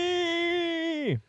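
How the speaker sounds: long high call of her name; calling Annie. Retold or imitated. Imitating husband calling his wife, long and high. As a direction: cry the name out across the water. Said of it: "As he says once".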